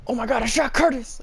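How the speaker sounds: high-pitched melodramatic cry; mock horror. Imitates dad's botched movie quote.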